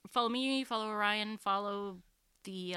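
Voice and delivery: ordinary talk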